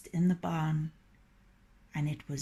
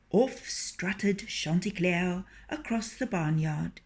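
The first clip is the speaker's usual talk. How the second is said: ordinary delivery, nothing marked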